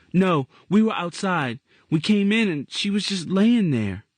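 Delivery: put-on high child's voice, cheerful; a boy calling to his aunt; young boy voice. Boy character voice.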